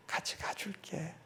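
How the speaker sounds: soft whisper of God's promise; God whispering that he will walk along; whispered reassurance. Retold or imitated. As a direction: breathe it gently like a comfort. God's gentle reassurance, retold.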